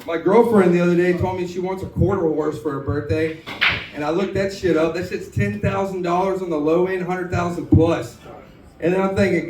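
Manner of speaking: usual speaking voice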